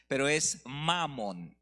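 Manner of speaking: "Mamón" slowed, with the weight on "Ma"; "Mamón" said slowly, first syllable stressed. Shown as a demonstration. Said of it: "Estoy enfatizando la primera sílaba".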